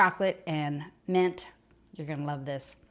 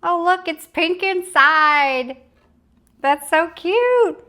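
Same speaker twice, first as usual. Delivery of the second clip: higher, louder and slow, gushing; delighted squeal; 10 semitones higher, 7 dB louder, slower than usual. Real, not acted. Host gushing to viewers at the pink fudge inside, high delighted coo. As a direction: coo over it like something adorable. Cutting open the fudge slice.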